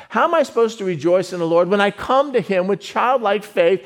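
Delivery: ordinary talk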